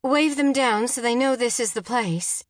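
high, insistent orders; frantic wife taking charge; urgent command. A narrator's reading. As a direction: order him firmly in alarm. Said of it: "Jen commanded".

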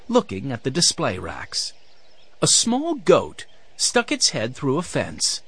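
ordinary delivery, nothing marked

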